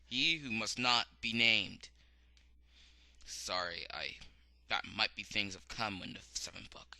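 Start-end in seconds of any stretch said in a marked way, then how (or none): 3.3-7.0 hushed spoiler whisper. Real, not acted. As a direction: whisper it like a secret.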